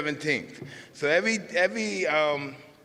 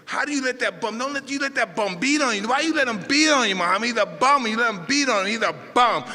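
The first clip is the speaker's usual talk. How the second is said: imitating Cus yelling, higher and louder, rising to a shout; angry scolding; 7 semitones higher, 6 dB louder than usual. Cus chews out Ali on the phone after the loss, a loud angry rant. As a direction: berate him like a furious old trainer.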